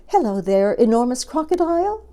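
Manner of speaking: high, lilting bird greeting; singsong bird. A narrator's reading. Bird greeting the crocodile from its nest, high singsong. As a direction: sing the greeting out, climbing high. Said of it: "sang the Roly-Poly Bird".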